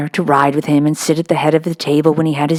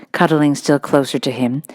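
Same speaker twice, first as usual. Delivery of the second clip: usual speaking voice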